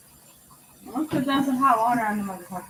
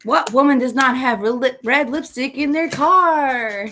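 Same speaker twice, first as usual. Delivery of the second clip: loud; 5 semitones higher, 7 dB louder than usual. Real, not acted. Mock outraged rhetorical question.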